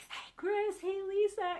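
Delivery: high, bright-pitched wife voice greeting her husband; Lisa's cheerful greeting. Acted. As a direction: play the wife saying hello, lifted high. Lisa greeting Chris, voiced.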